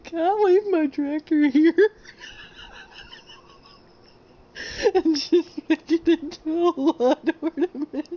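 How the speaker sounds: high, loud talk through laughter; laughing hard. Real, not acted. Streamer stuck with her tractor, laughing hard. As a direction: barely get the words out for laughing.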